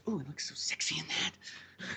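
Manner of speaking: slow, hushed, whispered swoon; playful sexy whisper, very soft; mock sultry whisper. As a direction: whisper it like you're admiring yourself in the mirror. Mock admiring himself in tight shirt.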